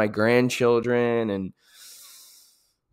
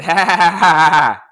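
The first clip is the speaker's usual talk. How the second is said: loud mocking ha ha ha; defiant mock laugh; 5 semitones higher, 6 dB louder than usual. Runner mocking his tired mind with a loud laugh. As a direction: laugh off the pain. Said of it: "And I said".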